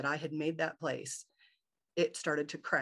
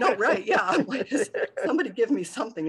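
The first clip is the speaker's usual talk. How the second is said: laughing through a fast, loud, higher quote; amused, pleading for a guide; 7 semitones higher, 9 dB louder than usual. Real, not acted.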